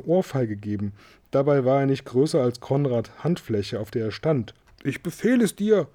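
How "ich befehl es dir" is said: tiny man's high-pitched command; small, high, imperious cry; calling out an order. A narrator's reading. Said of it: "rief er".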